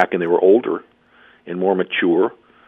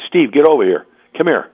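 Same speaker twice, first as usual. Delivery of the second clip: louder, higher snapped summons to the player; retold angry call; 7 semitones higher, 4 dB louder than usual. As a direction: call him over like you're still fuming. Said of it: "'cause I was mad".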